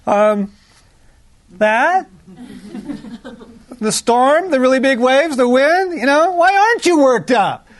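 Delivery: teasing imitation of disbelieving followers; incredulous disciple. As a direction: play the baffled disciple pointing at the storm. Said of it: "Just go".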